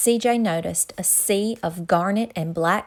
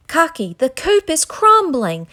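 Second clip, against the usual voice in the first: panicked warning; 8 semitones higher, 7 dB louder than usual. A narrator's reading. CJ warns Cocky in panic.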